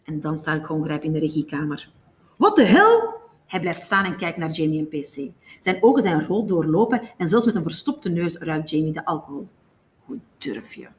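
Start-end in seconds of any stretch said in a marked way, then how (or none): 2.4-3.2 high, loud, startled exclamation; shouted, outraged surprise; loud outburst. A narrator's reading. Gongrijp startled in the control room. 10.1-10.9 very soft, low mumble; low drunken muttering. A narrator's reading. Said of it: "mompelt Gongrijp".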